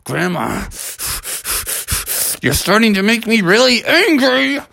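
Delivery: seethe, then let the anger climb; barely contained cartoon anger; mounting anger. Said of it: "And Bubby was like".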